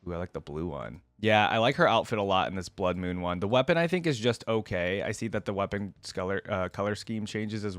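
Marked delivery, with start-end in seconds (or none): none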